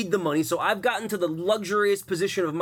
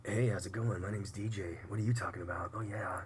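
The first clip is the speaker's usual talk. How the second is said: a hint of a soft, low flirty phone voice; phone actor voice; 7 semitones lower, 11 dB quieter than usual. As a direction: purr it like a phone flirt, lightly. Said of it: "I'm an actor on the phone".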